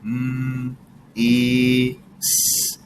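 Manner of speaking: letter by letter M, E, S; sounding out. Shown as a demonstration. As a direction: segment miss into M, E, S. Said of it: "Let's sound it out".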